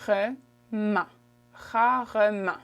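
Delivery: rarement slowly broken into ra, re, ment; proper pronunciation. Shown as a demonstration. Teacher models it for learners, rarement syllable by syllable. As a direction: separate every syllable of rarement, then join them. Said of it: "the way you said properly".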